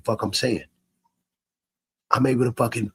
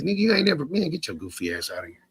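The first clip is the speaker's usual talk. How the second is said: dismissive callout; 5 semitones higher, 4 dB quieter than usual. Shown as a demonstration. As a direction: shoo the stranger out with scorn. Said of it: "especially when I get to talking like this".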